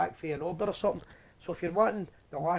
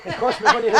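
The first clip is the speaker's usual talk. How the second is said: louder, higher, talking through laughter; laughing; 6 semitones higher, 8 dB louder than usual. Real, not acted. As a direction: laugh your way through the admission. Laughs at own reading slip.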